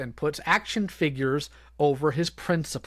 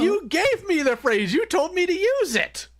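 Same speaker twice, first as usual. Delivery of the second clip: high, indignant teasing; playful protest; 11 semitones higher than usual. Real, not acted. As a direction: squeak back at the co-host.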